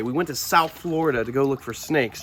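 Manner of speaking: ordinary talk, nothing marked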